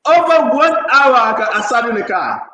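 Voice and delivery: exasperated loud outburst; loud exclaim. Real, not acted. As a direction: belt it out, amazed. Exclaiming about the long phone call.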